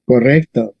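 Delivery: usual speaking voice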